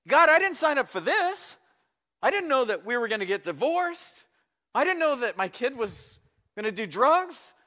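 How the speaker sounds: high, loud acted complaint to God; disillusioned believer. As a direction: protest at the top of the voice, piling up grievances.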